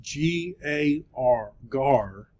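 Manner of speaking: "G A R" letter by letter, slowly, then "gar" whole; spelled out. Shown as a demonstration. Teacher spelling the Greek word for students, spelled out. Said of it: "This is gar".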